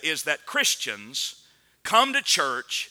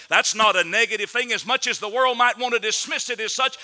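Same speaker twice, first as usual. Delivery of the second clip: louder, higher pleading appeal; preaching; 6 semitones higher, 4 dB louder than usual. Acted. Preacher voicing God's invitation to the church, rising preaching. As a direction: call them home from the pulpit.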